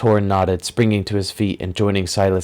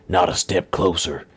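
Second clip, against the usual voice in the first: villain warning. Acted. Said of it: "the man said".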